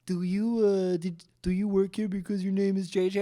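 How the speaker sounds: high, bright imitation of curious patrons; mock customer voice. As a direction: play the nosy bar customer asking. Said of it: "People are always like".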